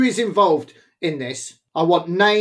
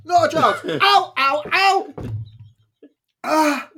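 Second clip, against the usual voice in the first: yelling through a burning mouth; pained shouting; 6 semitones higher, much slower than usual. Real, not acted. Refusing more chip, in pain.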